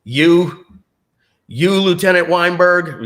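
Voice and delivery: a touch of Colonel Jessup; Colonel Jessup impression. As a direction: lean into a courtroom tirade. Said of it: "Colonel Jessup is saying".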